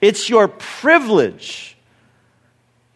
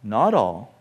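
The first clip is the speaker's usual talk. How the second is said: usual speaking voice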